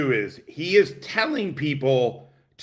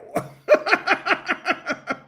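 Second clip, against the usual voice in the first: high burst of real laughter. Real, not acted. Streamer cracking up at the reality show, high laugh. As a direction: cackle at the show.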